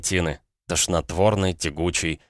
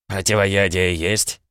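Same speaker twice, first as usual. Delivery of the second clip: slow matter-of-fact question; businesslike; slower than usual. Acted. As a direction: ask it like a practical innkeeper. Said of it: "деловито спросил Пётр".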